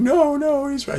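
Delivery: high-pitched mock crowd voice; theater crowd. Fan in the movie theater, squealing high in mock disbelief. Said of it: "people in the movie theater going".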